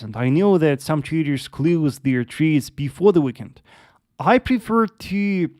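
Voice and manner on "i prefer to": ordinary talk, nothing marked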